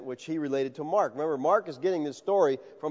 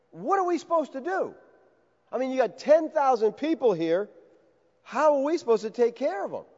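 exasperated disciples; 6 semitones higher than usual. Retold or imitated. Disciples overwhelmed by huge crowd.

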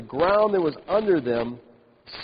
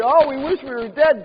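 high, louder melodramatic moan; whiny mock lament; 8 semitones higher, 5 dB louder than usual. Preacher voicing the complaining people, hammed-up wail. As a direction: overplay the grumblers' despair. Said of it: "They say".

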